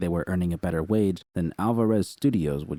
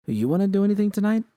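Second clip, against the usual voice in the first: lightly voiced eager question, higher and louder, quick; protagonist asking; 13 semitones higher, 5 dB louder, faster than usual. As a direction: toss the offer out brightly and fast.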